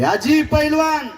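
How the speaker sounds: put-on high, drawn-out call to an old woman. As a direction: hail someone across the yard, slow and high. Caller asks old woman for wrestler.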